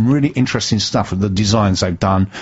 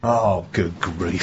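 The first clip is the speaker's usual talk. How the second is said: exasperated; 6 dB quieter, much slower than usual. Real, not acted. Mock despair at cohost.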